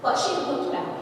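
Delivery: ordinary delivery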